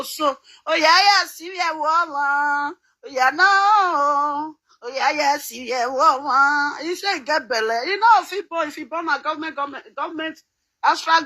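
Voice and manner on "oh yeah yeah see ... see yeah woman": slow exclamation with long held endings; theatrical wail. Acted. Lamenting over this woman, slow mock wail. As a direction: stretch each phrase into a mock lament.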